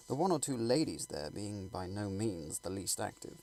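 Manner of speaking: usual speaking voice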